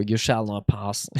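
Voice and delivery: low, slow wizard command; Gandalf quote. Retold or imitated. Quoting the wizard for chat, low and slow. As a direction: bar the way like a wizard. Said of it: "Reference if anyone gets it".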